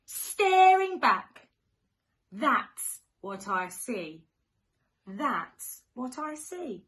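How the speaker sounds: sing-song storybook rhyme; reading to children. Acted. Teacher reading to little ones, sing-song and playful. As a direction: read it like a bedtime picture book. Reads rhyming picture book.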